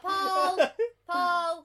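loud, high call for someone absent; playful pleading shout. Pretend. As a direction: holler the name. Calling for Paul to return.

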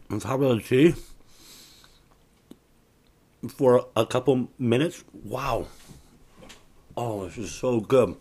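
eating while talking; words round a bite; chewing noisily. Real, not acted. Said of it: "I'm sorry I'm eating on the air".

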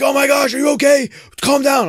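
high, loud frantic pleading; panicked family. Retold or imitated. Family begging him to calm down, frantic. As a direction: fuss over him in a panic. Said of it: "they're like".

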